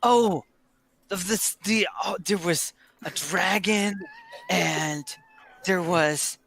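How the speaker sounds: put-on old drinker recalling wonders in broken bursts; excited old storyteller. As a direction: stumble eagerly back into the story. Old Flippin resumes his tale.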